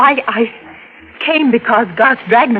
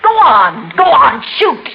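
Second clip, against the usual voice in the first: louder, rising tearful dare; louder, near-crying challenge to shoot; defiant dare; 4 semitones higher, 4 dB louder, slower than usual. Acted. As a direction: goad him to pull the trigger. Daring him to shoot.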